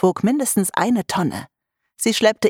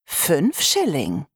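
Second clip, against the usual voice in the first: slow tempting offer; much slower than usual. Acted. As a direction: let each word hang. Mother bribes with money.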